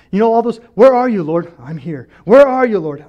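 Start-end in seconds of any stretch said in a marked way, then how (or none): 0.7-1.5 loud cry to God; loud, demanding mock complaint. 1.6-2.1 low, soft answer; reply calmly, dropped low and soft; calm voice of God, lightly played; calm reassurance. God's calm answer to complaints. 2.2-3.0 loud cry to God; loud, demanding mock complaint.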